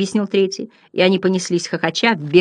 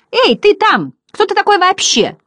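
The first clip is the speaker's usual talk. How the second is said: very high, loud clown squawk; shouting; 14 semitones higher, 4 dB louder than usual. A narrator's reading. First butterfly challenges Bastian, high loud shout. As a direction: holler it in a high, cheeky voice. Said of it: "Первый крикнул".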